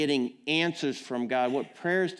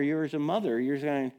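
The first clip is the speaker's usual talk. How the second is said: normal talking voice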